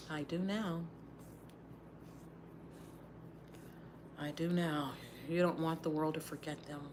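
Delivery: soft, low and sorrowful; quiet grief. Real, not acted. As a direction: let it sink to a hushed, pained realisation. Grieving brother and friend, near tears.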